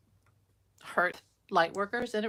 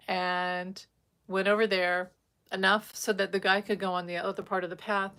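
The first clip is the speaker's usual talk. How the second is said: usual speaking voice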